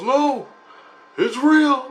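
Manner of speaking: high-pitched imitated astonishment; retold amazement. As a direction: leap up high as if stunned by the discovery. Retells amazed answer about dog.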